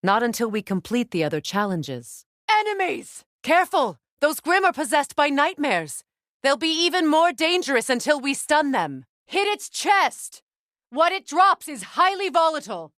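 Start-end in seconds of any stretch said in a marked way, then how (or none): 2.5-12.9 high, loud alarm calls; battle warnings shouted. Acted. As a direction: warn your ally over the noise of the fight. Combat warnings during fight.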